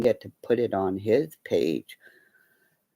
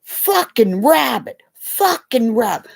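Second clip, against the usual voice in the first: mock toddler shouting the word; little son's half-hearted loud chant; child yelling; 8 semitones higher, 5 dB louder than usual. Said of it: "All of a sudden he goes".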